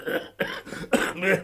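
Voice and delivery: a dry breathy cough; smoker's cough. Acted. Old professor greeting his guests, dry smoker's cough. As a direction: cough from too many cigarettes.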